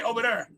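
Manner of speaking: "Over there" with the TH hardened to a D; local pronunciation. Shown as a demonstration. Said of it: "And we say".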